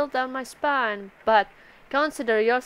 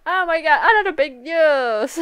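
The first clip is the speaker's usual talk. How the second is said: excited mock gasp; 6 semitones higher, 6 dB louder than usual. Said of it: "is like".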